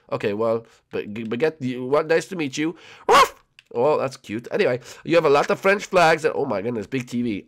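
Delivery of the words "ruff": one high, loud yap; sharp imitated woof; dog bark. Voices the dog Baguette.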